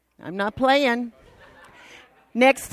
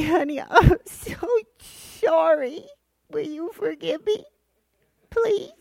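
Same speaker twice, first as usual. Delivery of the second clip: sugary pleading, rising high at the end; sweet apology; 5 semitones higher than usual. Retold or imitated. Wife sweetly begging her husband to forgive her, pleading. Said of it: "when I'm real sweet like that".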